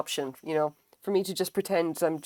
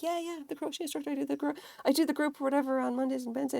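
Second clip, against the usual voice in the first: higher, fast, tired recital; rattled-off repeat; 9 semitones higher, 3 dB quieter, faster than usual. Retold or imitated. Repeating her role to every visitor, fast and higher. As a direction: rattle it off like the hundredth time. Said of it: "I had to say that so many times".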